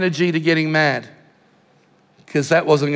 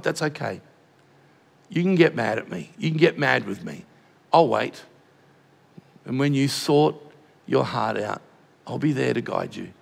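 soft, low and reassuring; gentle voice of God; 6 semitones lower, 6 dB quieter than usual. Retold or imitated. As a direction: speak as God comforting a grieving father, softly. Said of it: "I felt from God".

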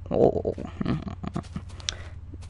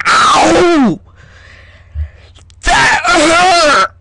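pained yells; 8 semitones higher, much slower than usual. Real, not acted. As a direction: yelp it out, really hurt. Hurting himself, crying out.

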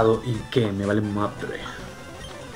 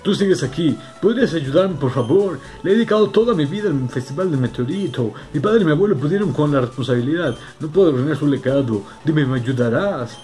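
put-on raised, pleading old leader; fretful elder voice; 4 semitones higher, 3 dB louder than usual. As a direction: beg for help anxiously. Voicing distressed old Elder.

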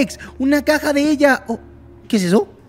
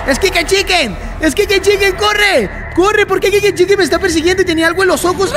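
panicked shouting; 5 semitones higher than usual. Real, not acted. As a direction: scream at yourself to run. Chased by monster.

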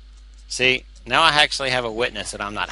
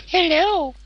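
high squeaky little hello; tiny high-pitched cartoon voice; peeking creature voice. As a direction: pop out with a squeaky, drawn greeting.

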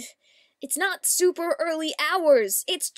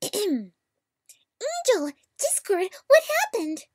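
higher-pitched cry of concern; acted alarm; 4 semitones higher, much slower than usual. Voice actor calling to Angel and Discord, alarmed. Acting a scripted line, calling out.

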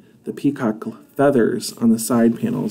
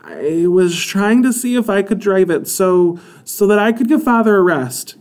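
pleading; 8 semitones higher, 3 dB louder than usual. A narrator's reading. Daughter pleads her reason.

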